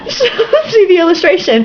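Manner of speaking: words squeezed out through a crying laugh; high and giggling, barely holding it together. Real, not acted. Reader laughing at the book's picture while narrating.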